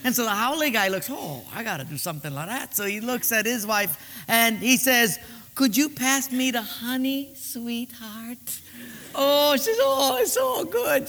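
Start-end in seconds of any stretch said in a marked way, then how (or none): 1.1-2.8 muttered thought. Acted. Haole guy thinking aloud. 5.5-8.6 sweet-talking husband. Acted. Romantic husband to wife. 9.8-11.1 high, slow, swooning wife. Acted. Flattered wife swooning at her husband's sweet words.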